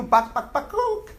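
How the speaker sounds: high squeaky bakuk cluck; chicken clucking. Pretend. Teacher repeating the chicken cluck to tease the class. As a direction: squawk it like a hen. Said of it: "How does the chicken sound?".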